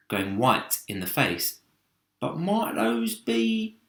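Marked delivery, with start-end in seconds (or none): 2.2-3.7 slow, high fearful voice; slow high dread. Acted. Centipede realising the danger, slow fearful voice. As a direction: ease it out fearfully.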